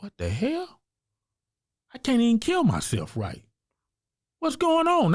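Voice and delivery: high, exasperated retelling of his own outburst; reliving the moment the gun failed, incredulous and climbing; frustrated disbelief. Retold or imitated. Man retelling his failed attempt, high and exasperated. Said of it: "I'm like".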